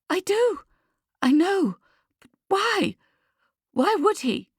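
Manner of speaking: very high-pitched, incredulous questioning; stunned disbelief. A narrator's reading. Lucia hearing the news on the phone, stunned disbelief. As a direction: pitch right up, struggling to take it in.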